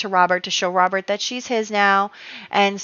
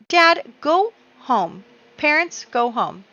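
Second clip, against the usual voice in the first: exasperated ordering; 7 semitones higher, slower than usual. Pretend. Ordering game characters to leave.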